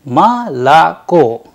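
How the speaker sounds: "ma la ko" slowly, syllable by syllable. Shown as a demonstration. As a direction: drag out ma la ko with pauses. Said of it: "it sounds like".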